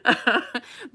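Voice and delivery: high, breaking into giggles; laughing. Real, not acted. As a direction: laugh through the words. Laughing over the sheriff's words.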